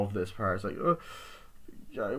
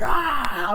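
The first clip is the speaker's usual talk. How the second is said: high, loud mock shriek; horror-movie shriek; scream; 10 semitones higher, 6 dB louder than usual. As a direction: scream at the sight. Girl screams seeing boyfriend.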